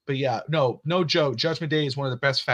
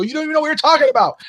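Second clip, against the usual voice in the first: put-on high, fast, louder indignant kid; daughter's voice; 14 semitones higher, 7 dB louder, faster than usual. Daughter scolding mom, imitated in a high, fast kid voice. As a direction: rattle it off like an annoyed child. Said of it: "I go".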